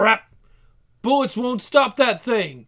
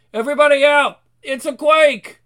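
usual speaking voice

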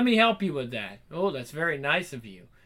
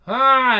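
high, loud drawn-out moan; acted moan; 8 semitones higher, 12 dB louder than usual. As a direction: let out a loud mock moan. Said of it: "the moans get out of hand".

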